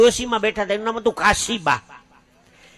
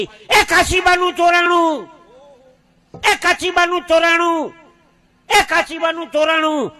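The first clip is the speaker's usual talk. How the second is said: high, slow cry of "એ કાશીબાનું ચોરાણું", the word એ stretched and loud; alarmed shout; 8 semitones higher, 4 dB louder, slower than usual. Retold or imitated. Passenger on the night train yelling to everyone, high, drawn-out alarm shout. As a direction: call out across the train like someone raising an alarm. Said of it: "એક જણે ઓચિંતાની બૂમ પાડી".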